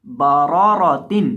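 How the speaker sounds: बारारातिन said slowly with both ra full; slow careful reading. Shown as a demonstration. Teacher modelling बारारातिन slowly for students. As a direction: lay out बारारातिन syllable by syllable. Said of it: "पुर करके पढ़ा जाएगा".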